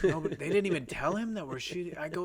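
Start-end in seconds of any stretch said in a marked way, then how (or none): none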